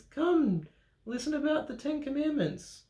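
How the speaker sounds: high, calling out to the crowd; high villager voice. Acted. Narrator voicing a villager calling people over, high voice.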